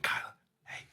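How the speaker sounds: whispered, discreet call for attention; hushed, retold friendly nudge. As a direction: whisper it gently to the person beside you. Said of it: "I did it so friendly".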